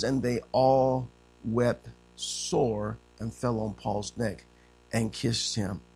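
slow, low, soft reading; scripture reading. Acted. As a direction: read the verse gently and slowly. Reads scripture aloud.

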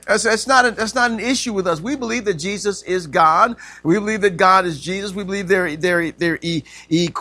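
ordinary talk, nothing marked

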